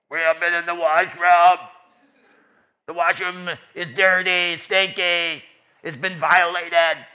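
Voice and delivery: loud, booming school intercom announcement; stern PA announcement. Retold or imitated. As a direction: blare it like the principal on the loudspeaker. Principal's threat over PA.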